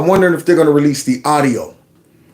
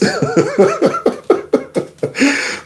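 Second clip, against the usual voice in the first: high laugh. Real, not acted. Big laugh.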